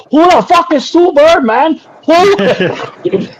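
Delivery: high, loud, indignant yell; high-pitched mock outrage; yelling Chinese guy. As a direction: imitate a man yelling in disbelief. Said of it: "just this Chinese guy going".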